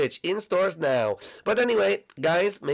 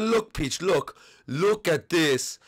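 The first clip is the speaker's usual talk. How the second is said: ordinary talk, nothing marked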